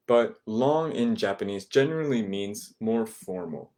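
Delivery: usual speaking voice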